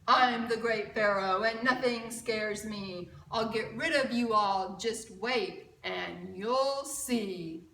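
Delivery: loud, proud king bragging, slowing at the end; boastful Pharaoh. Acted. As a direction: boom it out like a cocky ruler. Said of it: "Pharaoh ran quickly and picked up a broom".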